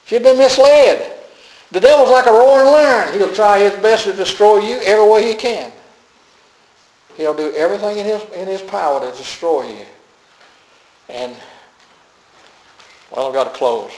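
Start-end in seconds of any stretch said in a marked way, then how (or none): 0.1-9.0 high, loud preacher's warning; forceful preaching. Real, not acted. Preacher warning the flock about the devil, forceful preaching. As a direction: proclaim the warning at full force.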